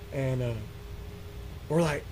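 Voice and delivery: ordinary talk, nothing marked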